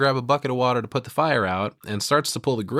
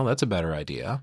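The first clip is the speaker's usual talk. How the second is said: ordinary talk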